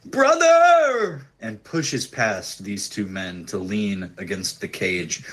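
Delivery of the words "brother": high, loud, desperate cry. Acted. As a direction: cry out for a long-lost brother. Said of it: "she cries out".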